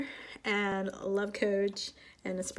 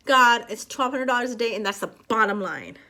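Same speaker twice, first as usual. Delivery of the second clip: firm, raised voice laying down terms; assertive; 6 dB louder than usual. Shown as a demonstration. Showing how to give God a quota, assertive. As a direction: state it loud and sure, no wavering. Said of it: "I say it like that".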